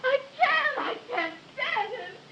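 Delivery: crying, pleading, slowed; sobbing. Acted. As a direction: break down in tears. Distraught girl breaks down.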